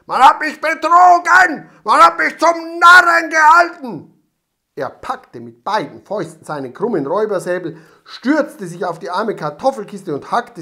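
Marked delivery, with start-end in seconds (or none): 0.1-4.2 screaming, high and loud; furious shout. A narrator's reading. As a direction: rage at being cheated. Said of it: "schrie ... zornig". (zornig geschrien)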